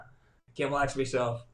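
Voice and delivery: ordinary delivery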